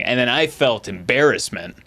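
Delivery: ordinary talk, nothing marked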